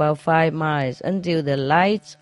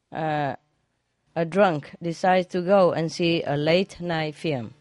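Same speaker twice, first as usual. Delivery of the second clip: usual speaking voice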